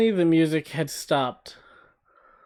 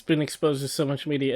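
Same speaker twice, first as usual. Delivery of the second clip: usual speaking voice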